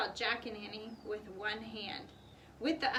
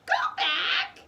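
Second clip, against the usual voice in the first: much higher, loud parrot cry; parrot squawk; 10 dB louder than usual. A narrator's reading. Polly warns the children, high parrot squawk. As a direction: squawk the warning. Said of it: "Polly squawked".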